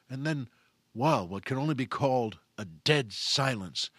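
ordinary talk

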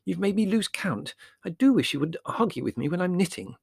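high, scolding mother; put-upon and cross; irritable. Acted.

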